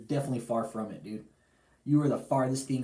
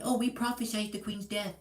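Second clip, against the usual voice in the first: mocking the cult; 9 semitones higher than usual. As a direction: lightly parody their pious claim. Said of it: "they're coming out saying".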